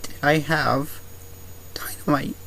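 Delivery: stunned, breathless fright; shaken. Acted. Right after the dynamite blows up.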